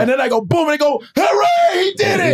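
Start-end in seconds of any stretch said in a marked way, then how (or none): 1.2-2.3 very high-pitched imitated hooray; mock cheer. Coaches celebrating the novice's first good punch, mock cheer. Boxers cheer him in story.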